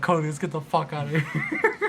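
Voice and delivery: half-laughing urgent shout; urgent plea. Retold or imitated. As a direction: call out to a friend, breaking into laughter. Retold urgent plea after screaming.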